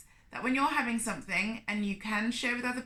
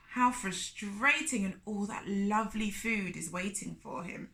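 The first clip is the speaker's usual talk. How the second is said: plain reading voice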